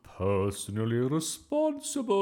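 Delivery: slow, loud, high warning; threatening. A narrator's reading. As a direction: threaten the boy with drawn-out menace. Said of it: "Miss Boot glared at Bertie".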